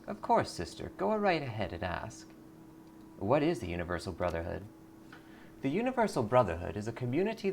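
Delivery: sugary cult greeter; lightly voiced sweet cult recruiter. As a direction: welcome her in like a smiling recruiter.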